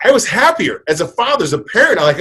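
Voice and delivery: usual speaking voice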